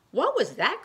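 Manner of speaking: high, loud startled chick; startled cry. Acted. Baby bird startled by a bat, high cry. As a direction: yelp it out in alarm. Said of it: "cried Flap".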